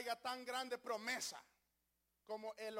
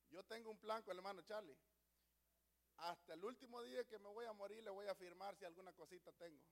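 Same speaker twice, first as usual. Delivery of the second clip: hushed aside; 5 semitones lower, 7 dB quieter, faster than usual. Real, not acted. Joking aside to the congregation.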